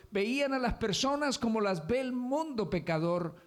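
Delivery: high, insistent preaching cadence; fervent sermon on two worldviews; full-force preaching. Acted.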